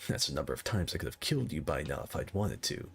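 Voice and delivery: low, brooding anime hero; menacing anime-kid line, lightly voiced; earnest determined anime hero. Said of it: "He's like".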